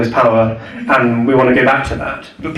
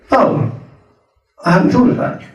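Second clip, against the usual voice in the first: high, airy mock surprise with a laugh; mock innocent surprise; 7 semitones higher than usual. Retelling Lawson's reply to Dom, mock surprised and laughing. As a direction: play a politician caught out, lightly. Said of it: "the most astonishing thing that any politician said".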